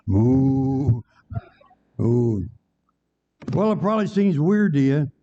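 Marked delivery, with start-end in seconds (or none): 0.1-2.5 long, drawn-out moo at speaking pitch; imitated cow moo. City kid up a tree, badly judged animal disguise, mooing. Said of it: "that poor guy still up the tree".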